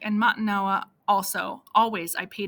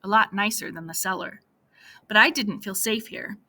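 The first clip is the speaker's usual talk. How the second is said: ordinary talk, nothing marked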